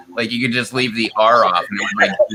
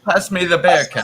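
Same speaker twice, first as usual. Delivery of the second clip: laughing mock German accent, a bit louder; 5 semitones higher, 4 dB louder than usual. Shown as a demonstration. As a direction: joke the line out in a German accent, chuckling. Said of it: "weird German ac-, accent to it".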